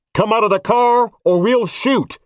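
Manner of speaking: police bullhorn threatening to shoot; bullhorn threat. A narrator's reading.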